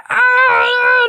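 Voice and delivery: high strained cry; high effortful yell. Retold or imitated. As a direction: push out the rep. Straining on rep.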